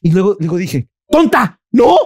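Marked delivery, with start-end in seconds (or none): 1.1-1.9 loud, high-pitched self-reproach; mock self-scolding. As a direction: exclaim it as if telling yourself off. Se regaña a sí misma, actuado. (regaño exagerado a sí misma)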